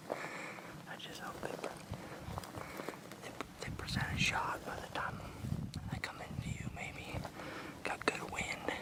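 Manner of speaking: hushed hunter's whisper. Real, not acted. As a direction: whisper so the deer won't hear. Whispering while stalking deer.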